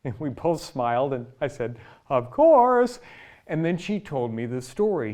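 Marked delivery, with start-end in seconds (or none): 2.1-3.0 high, loud "Of course"; knowing reply. Retold or imitated. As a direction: exclaim it as the obvious answer.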